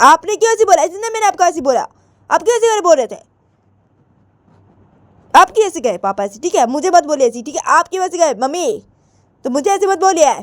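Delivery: high, insistent back-talk; Poonam, protesting. Acted. Daughter telling mummy not to blame her, indignant.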